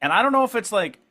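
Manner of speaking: ordinary delivery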